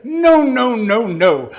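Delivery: high, loud noes sliding down; exasperated objection. Acted. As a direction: cry out in protest, then come down. Exasperated interlocutor rejects answer.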